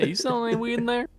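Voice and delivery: high, quick mock inquiry; hopeful door-knocker. Stranger at a random house asking for weed, high eager voice.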